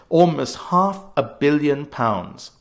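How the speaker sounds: "almost half a billion pounds" slowed right down, each word weighed; slow, deliberate hammering of the debt figure; slow, weighty repetition. Real, not acted. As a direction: drag out "half a billion" to make it hit. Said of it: "repeated figure, slowed for weight".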